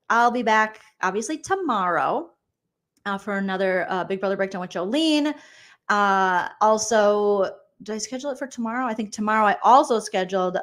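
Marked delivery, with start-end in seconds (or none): none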